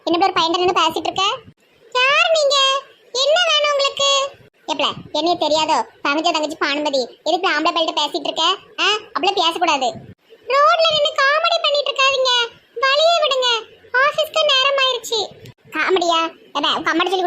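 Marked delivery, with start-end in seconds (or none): 1.9-4.1 high-pitched guarded questioning; wary young woman. Acted. Munis asking a woman what she wants, wary and high-voiced. 10.5-15.2 much higher-pitched curt character voice; impatient young woman. Acted. Munis telling a woman to clear the way, impatient and high-voiced.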